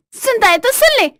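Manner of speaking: very high, loud outburst; shrieking indignant wife; defiant yelling. Acted. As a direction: shout so the neighbours hear. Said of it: "तुम ऐसे क्यों चिल्ला रही हो".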